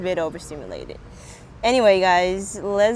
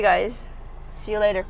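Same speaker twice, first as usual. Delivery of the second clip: usual speaking voice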